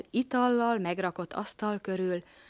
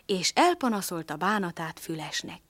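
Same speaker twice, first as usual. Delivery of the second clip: ordinary delivery, nothing marked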